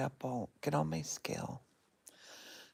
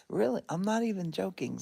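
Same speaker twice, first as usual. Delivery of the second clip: emphatic; 4 semitones higher, 7 dB louder than usual. Real, not acted.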